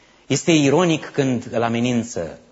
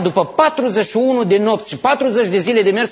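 normal talking voice

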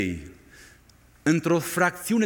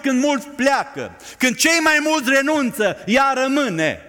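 preaching at full force; 6 semitones higher than usual. Acted. Preacher driving home Mary's longing to the congregation, fervent. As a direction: build the plea with fire.

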